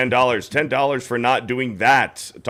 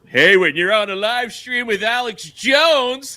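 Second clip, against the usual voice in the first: high, slow, teasing singsong; slow mock imitation of a bragging friend; mocking quote; 10 semitones higher, 4 dB louder, slower than usual. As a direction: ham up his casual brag. Mocking friend's casual name drop.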